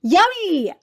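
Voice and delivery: high, loud, joyful call of the guest's name; excited welcome. Real, not acted. Podcast host welcoming her guest: joyful call. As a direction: greet her like a star arriving.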